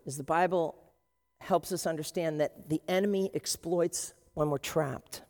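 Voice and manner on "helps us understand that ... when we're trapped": ordinary delivery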